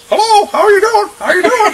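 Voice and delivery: squeaky puppet talking through still lips; ventriloquist dummy voice. Shown as a demonstration. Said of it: "watch, watch".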